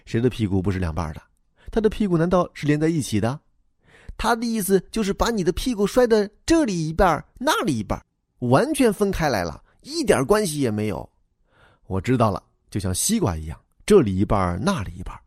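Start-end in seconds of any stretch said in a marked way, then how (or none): 4.2-11.1 put-on high, childlike voice for the little boy; young boy replying to the grown-up, high and eager; boy's voice. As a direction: play the earnest schoolboy explaining himself. Boy explains father's boast.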